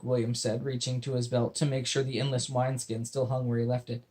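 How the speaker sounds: ordinary delivery, nothing marked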